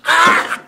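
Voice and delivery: sudden high yelp of burning pain; pained yell. Real, not acted. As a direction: cry out as the heat hits. Tasting extremely spicy chili.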